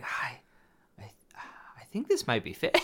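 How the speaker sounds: hushed, halting confession; small, hesitant voice trailing into a whisper; sheepish admission. Retold or imitated. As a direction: break it gently, nearly whispering by the end. Sheepish retold text message.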